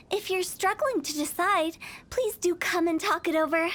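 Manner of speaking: ordinary talk, nothing marked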